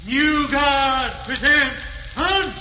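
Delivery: high, carrying parade-ground call; answering sergeant calling the drill; drill command. Acted. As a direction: call the order to the new guard.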